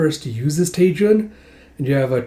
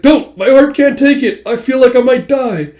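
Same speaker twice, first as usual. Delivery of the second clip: high, loud, hammed-up wail; panicked plea; 10 semitones higher, 7 dB louder than usual. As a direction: beg as if your heart will burst. Character panics before hearing her feelings.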